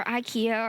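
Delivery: ikea said EYE-kee-a; alternate pronunciation. Shown as a demonstration. Streamer offers viewers another way to pronounce it, ikea as eye-KEE-a. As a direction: say ikea with a long "eye" at the start. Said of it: "or however you guys pronounce it".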